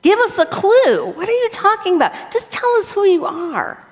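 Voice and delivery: very high, incredulous questioning; hammed-up bewilderment; confused disciples. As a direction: plead for a clue, comically exasperated. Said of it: "The disciples are saying".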